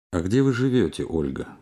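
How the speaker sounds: normal talking voice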